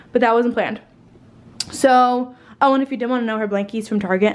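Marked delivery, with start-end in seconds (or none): none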